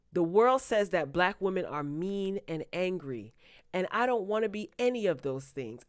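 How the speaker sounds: ordinary delivery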